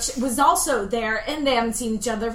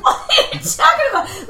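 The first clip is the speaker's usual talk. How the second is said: laughing disbelief; 12 semitones higher, 6 dB louder than usual. Retold or imitated. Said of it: "I'm like".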